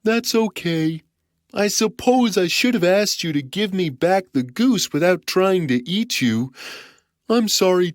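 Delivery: put-on forgiving giant kid. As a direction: make peace as a childlike giant. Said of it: "said the giant".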